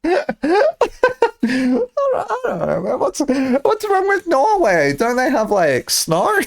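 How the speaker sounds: high, slow words through giggles; laughing while talking. Real, not acted. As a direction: talk while cracking up.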